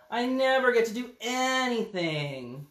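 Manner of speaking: high, slow whining; whiny pigeon. Acted. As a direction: sulk like a left-out kid. Pigeon whines.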